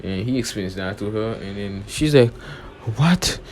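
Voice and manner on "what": high, loud shocked gasp of a word; shocked what. Retold or imitated. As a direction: blurt it out in sudden disbelief. She learns of the betrayal.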